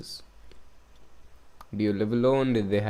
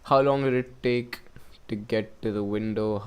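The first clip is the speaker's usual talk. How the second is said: ordinary talk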